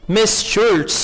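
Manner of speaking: ordinary talk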